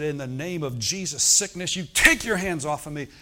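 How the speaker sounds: loud rebuke rising on sickness; commanding shout. Retold or imitated. Preacher reenacting commanding his sickness to leave, loud. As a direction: order the sickness off with full force. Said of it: "I said".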